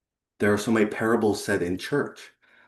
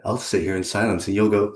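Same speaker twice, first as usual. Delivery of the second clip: ordinary delivery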